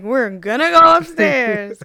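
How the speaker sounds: put-on loud, slow, drawn-out drunken cheer; drunk mind. Drunk mind overrules the sober voice, loud and slow. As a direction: whoop it out like a tipsy party girl. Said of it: "my drunk mind is like".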